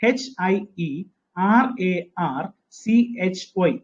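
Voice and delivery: each letter said slowly and separately; teacher spelling out the word slowly. Shown as a demonstration. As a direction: dictate letter by letter. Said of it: "hierarchy എന്ന പദം".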